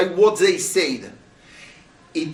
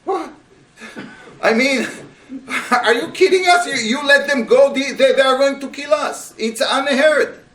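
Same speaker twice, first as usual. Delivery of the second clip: high, loud outraged disbelief; incredulous outrage; 6 semitones higher, 5 dB louder than usual. Retold or imitated. Troops accuse their commander, loud and disbelieving. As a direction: let them hear how crazy this is.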